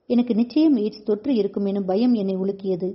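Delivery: ordinary delivery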